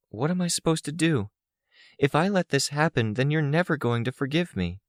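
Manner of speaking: high, lost pleading voice; forlorn. A narrator's reading. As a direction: ask helplessly, pitched high. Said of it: "Her expression was forlorn, lost".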